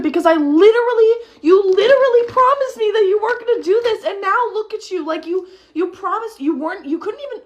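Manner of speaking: high, indignant rant tripping over itself; worked-up scolding. Retold or imitated. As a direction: lay into him until the words tangle. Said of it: "I can't even speak".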